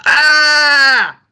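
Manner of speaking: high, loud cry of pain; scream. A narrator's reading. Son falling on the mountain, screams in pain. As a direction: scream out as if hurt in a fall. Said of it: "and screams".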